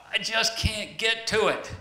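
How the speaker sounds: "I just can't get to it" lifted high, lightly exasperated. Retold or imitated. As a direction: playfully complain how out of reach it is. Frustrated ladder story, said twice.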